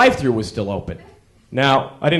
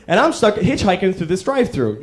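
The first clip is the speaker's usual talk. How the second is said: ordinary talk, nothing marked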